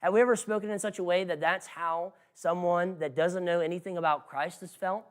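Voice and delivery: usual speaking voice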